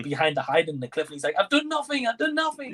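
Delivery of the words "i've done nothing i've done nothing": much higher mumbled Rambo impression; a hammed-up try at Rambo's accent; Rambo accent attempt. Fan mimics Rambo pleading his innocence, hammed-up accent. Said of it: "I can't do his accent".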